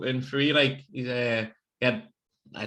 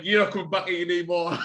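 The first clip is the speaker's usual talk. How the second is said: mock banishment through laughter; 6 semitones higher than usual. Retold banishment, teller cracking up.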